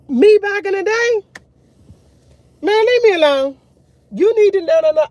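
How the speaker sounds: high, loud, dismissive put-on voice of her younger self; old stubborn me. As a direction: brush everyone off like your old stubborn self.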